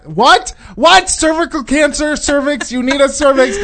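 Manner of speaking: high, loud incredulous outburst; retold shocked disbelief. Reacting to co-host's dream vaccination plan, incredulous. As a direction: splutter in escalating mock shock.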